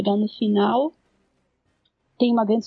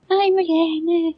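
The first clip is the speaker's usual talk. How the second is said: mocking quote; 8 semitones higher than usual. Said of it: "pataquadas de".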